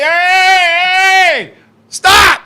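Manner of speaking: high, loud comic protest; hammed-up yell. Man begging girlfriend to stop, high mock yell. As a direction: holler for mercy, overdone. Acted cry to make her stop.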